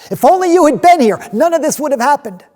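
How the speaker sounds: high, loud anguished wail; tragic heroine sorrow. Acted. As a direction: break down crying. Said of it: "they sobbed at his feet".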